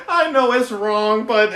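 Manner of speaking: guilty laughing admission. Real, not acted. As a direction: giggle while admitting it's wrong. Laughing at own dark joke.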